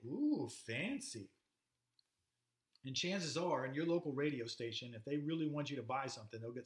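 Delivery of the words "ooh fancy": drawn-out, swooping mock-impressed coo. Teacher voices Americans hearing an English accent, slow mock awe. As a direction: coo it slowly, as if dazzled by poshness. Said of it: "we think".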